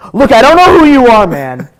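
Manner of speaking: loud, pushy bar patron; loud demand. Acted. A bar patron loudly demands the spoon. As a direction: cut in loudly, wanting one.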